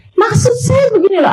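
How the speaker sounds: high-pitched, mildly irritated repeat; acted rising irritation at not being heard; exasperated. Explaining to someone hard of hearing, exasperated. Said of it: "kan suka rada naik darah".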